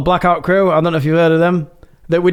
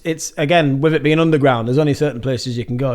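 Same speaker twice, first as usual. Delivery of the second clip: normal talking voice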